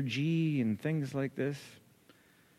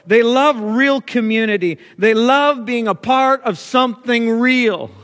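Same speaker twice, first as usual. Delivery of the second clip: loud, higher, slow pulpit climax; full-force preaching; 7 semitones higher, 9 dB louder than usual. Acted. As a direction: drive each line home to the congregation. Sermon peak.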